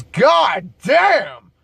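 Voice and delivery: imitating a drunk friend's loud ogling; retold tipsy holler of admiration; loud leering exclamation. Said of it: "loud enough for half the fucking bar".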